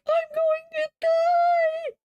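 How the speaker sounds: high, loud terrified cry, stretched out slow; panicked shriek. Retold or imitated. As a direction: shriek in total panic at the monster. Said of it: "and I'm just like".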